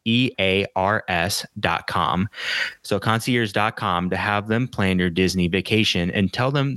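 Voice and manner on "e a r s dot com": spelled out slowly. Shown as a demonstration. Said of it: "that's Concierge as in".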